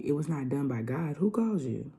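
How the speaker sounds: ordinary talk, nothing marked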